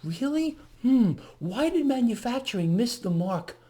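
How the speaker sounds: feigned wondering, high on the first word then settling; mockingly clueless; mock puzzlement. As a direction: play the baffled official, musing. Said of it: "They're like".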